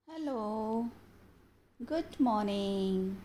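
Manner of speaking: very slow, deliberate delivery with pauses; measured, drawn-out teacher voice; storytelling to children. Acted. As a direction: introduce a story to small children, word by word.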